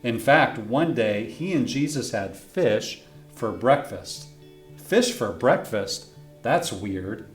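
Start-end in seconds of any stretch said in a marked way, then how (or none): none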